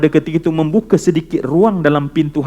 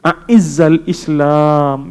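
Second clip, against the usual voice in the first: drawn prayer; 3 dB louder, much slower than usual. Acted. Prophet's prayer repeated slowly.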